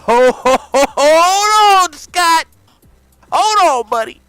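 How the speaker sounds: menacing buildup. Acted. Dana warning Scott in a loud, menacing buildup. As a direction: drag out the warning, building to a shout.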